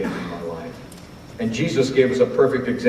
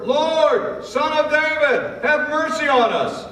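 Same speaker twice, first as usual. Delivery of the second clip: very high, loud, slower desperate cry; louder shouted plea; slower than usual. Acted. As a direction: shout it even harder than before, begging. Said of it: "they shouted all the louder".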